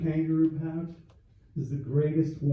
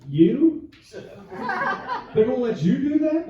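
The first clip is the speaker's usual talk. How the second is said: incredulous; 9 semitones higher, 8 dB louder, much slower than usual. Real, not acted. Disbelief at the son's job.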